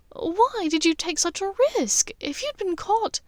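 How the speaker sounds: a hint of anxious girlfriend; lightly voiced Hermione. Hermione worried.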